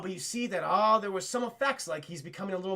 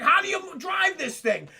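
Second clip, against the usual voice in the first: high, loud baffled question; mock exasperated yell; 7 semitones higher, 5 dB louder than usual. Mario stuck in the police car, loud mock bafflement. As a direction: shout it in mock confusion.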